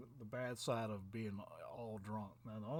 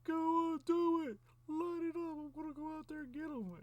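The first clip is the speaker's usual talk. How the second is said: much higher, much louder frantic yell; drunk screaming; 8 dB louder than usual. Retold or imitated. Drunk pub owner screaming as he charges outside. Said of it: "He's, like, screaming and everything".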